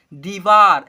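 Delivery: "दीवार" loud, with long ee and aa; model reading. Shown as a demonstration. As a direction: sound out "दीवार" emphatically for learners. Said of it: "ऐसे पढ़ेंगे". ("दीवार" को ज़ोर से, लंबी मात्राओं के साथ पढ़ें)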